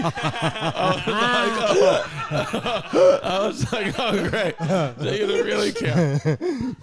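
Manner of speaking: laughing through the words; real amused laughter. Real, not acted. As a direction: chuckle while telling it. Laughing at the pedicure story.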